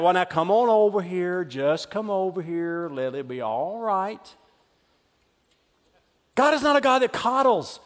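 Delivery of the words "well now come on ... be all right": much lower, softer, slow soothing coo; mock a God who babies his children; mock coddling voice.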